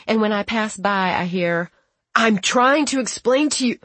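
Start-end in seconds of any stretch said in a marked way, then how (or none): 2.1-3.8 raised, frustrated explaining; exasperated. Retold or imitated. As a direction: push hard to be understood. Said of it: "His voice is rising and falling".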